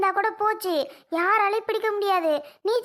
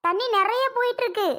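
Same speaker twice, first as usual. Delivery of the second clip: normal talking voice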